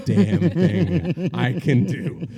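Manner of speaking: very slow, drawn out and laughing; laughing triumph. Retold or imitated. Man answers the woman's cheese challenge, very slow and laughing. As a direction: savour the line through laughter.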